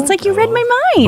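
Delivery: bright excited squeal; delighted. Real, not acted. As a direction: squeal with glee. Delighted at shared thought.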